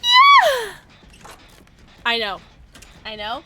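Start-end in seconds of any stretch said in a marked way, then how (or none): 0.0-0.7 high, loud yelled yeah; high loud character shout; Yoon Jin impression. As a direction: belt a shrill-pitched cheer as Yoon Jin. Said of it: "Here's my Yoon Jin impression".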